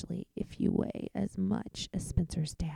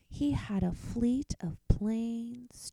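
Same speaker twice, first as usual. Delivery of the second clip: ordinary delivery, nothing marked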